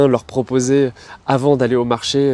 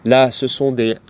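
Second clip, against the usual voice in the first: ordinary talk, nothing marked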